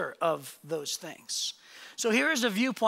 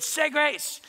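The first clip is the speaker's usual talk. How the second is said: higher, imitated fed-up command; mock wrathful mother's order; upset mom; 6 semitones higher than usual. Mom orders last son to pray, imitated upset. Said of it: "she was so upset".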